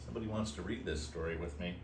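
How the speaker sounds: plain reading voice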